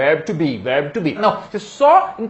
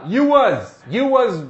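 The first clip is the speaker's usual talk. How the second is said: lightly acted, higher-pitched wrong English; someone speaking wrongly; 4 semitones higher, much slower than usual. Teacher imitating someone using bad grammar, lightly put on. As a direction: pitch it up slightly as another speaker. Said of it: "se alguém chegar pra você e falar assim".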